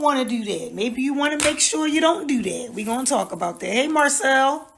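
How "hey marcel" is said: normal talking voice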